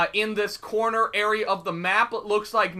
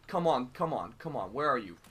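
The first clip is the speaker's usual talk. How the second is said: hushed; 12 semitones lower, 12 dB quieter than usual. Real, not acted. Sneaking, waiting for enemy.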